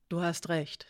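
whispered concession. Acted. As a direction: breathe the admission. Hiraya concedes softly. (geflüstert)

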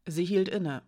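usual speaking voice

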